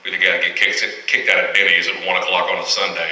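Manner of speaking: normal talking voice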